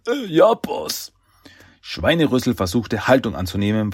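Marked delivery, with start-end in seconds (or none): 0.1-1.1 high, slow, hesitant henchman voice; goofy underling's drawn-out reply; dim henchman. A narrator's reading. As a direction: answer the boss sheepishly, pitched up. Scolded henchman answers his boss.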